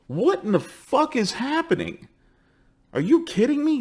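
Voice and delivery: high, pushed disbelief on you; can't believe what you're hearing; incredulous. Real, not acted. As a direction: stress on "you" in "Are you kidding me?". Ranting in disbelief.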